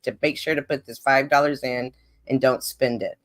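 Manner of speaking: ordinary talk, nothing marked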